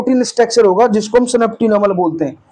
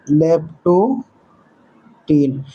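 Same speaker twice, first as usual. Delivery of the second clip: very slow, lower, split into parts; slow dictation; 4 semitones lower, much slower than usual. Real, not acted. Teacher writing the stage name, slow dictation. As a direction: spell it out by syllables for note-takers.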